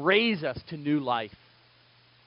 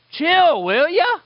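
put-on high, loud bratty kid; mock twelve-year-old; 11 semitones higher, 8 dB louder, slower than usual. Preacher mimicking a bouncing twelve-year-old girl, bratty and loud. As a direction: snap it like a huffy preteen. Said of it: "like a twelve-year-old".

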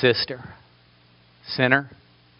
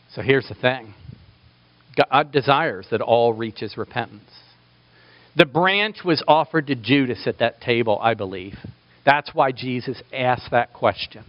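ordinary talk

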